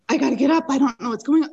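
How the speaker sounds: retold panic. Father-in-law lying in the wet canal, retold panic. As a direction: blurt it out, acting the panic. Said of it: "he started panicking".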